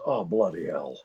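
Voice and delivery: low, weary Scottish star; low celebrity impression; Sean Connery. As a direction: grumble it as a Scottish film legend. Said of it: "and Sean goes".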